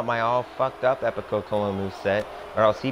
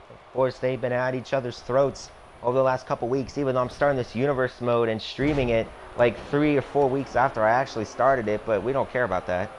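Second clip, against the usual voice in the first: ordinary delivery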